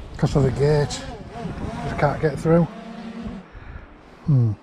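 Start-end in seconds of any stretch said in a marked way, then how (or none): none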